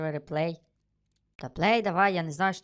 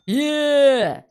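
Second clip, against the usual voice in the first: high, loud shout of triumph; jubilant cheer; 8 semitones higher, 7 dB louder than usual. Real, not acted. Player celebrating a pile of stolen money, jubilant. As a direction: cheer the big haul out loud.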